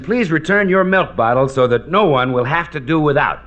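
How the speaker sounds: usual speaking voice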